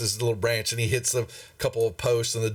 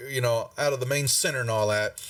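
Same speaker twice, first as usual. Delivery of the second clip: ordinary talk, nothing marked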